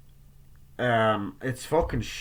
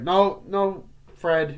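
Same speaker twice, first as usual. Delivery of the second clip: acted protest; 7 semitones higher, 6 dB louder, much slower than usual. Acting a reply to the janitor.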